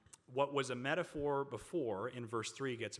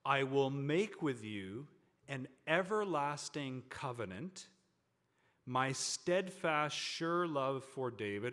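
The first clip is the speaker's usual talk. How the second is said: slow, loud Bible proclamation; emphatic pulpit reading with drawn-out key words; scripture reading; 2 semitones higher, 4 dB louder, slower than usual. Acted. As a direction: declare each phrase with weight, pausing between. Reads scripture aloud to congregation.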